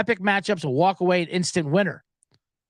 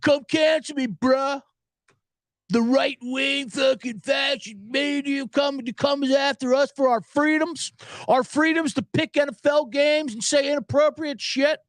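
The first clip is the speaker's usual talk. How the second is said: hammed-up outrage, with a shout on the media line; mocking that guy; 5 semitones higher than usual. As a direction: sneer it like a self-pitying victim. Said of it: "I'm not gonna be that guy".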